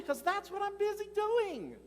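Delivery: high, slowed, mock-exasperated. Real, not acted. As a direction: stretch it out, amused at the irony. Pastor's exasperated punchline.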